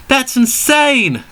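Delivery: high, slow outraged cry; cried out. Acted. Luke cries out at the villain's plan, high and slow. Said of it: "cried Luke".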